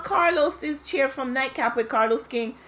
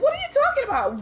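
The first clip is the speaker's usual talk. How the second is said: incredulous; faster than usual. Retold or imitated. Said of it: "Ladies were like".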